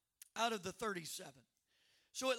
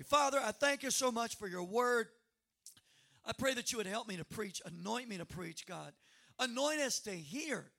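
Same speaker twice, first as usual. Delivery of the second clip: fervent, louder pastoral prayer; 5 dB louder than usual. Acted. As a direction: pray over the church.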